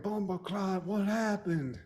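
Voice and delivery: soft, tentative mock Jamaican accent; badly faked Jamaican accent. Podcaster voicing gangster to earless man, bad Jamaican accent. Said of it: "I can't do a Jamaican accent".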